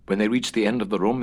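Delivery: ordinary delivery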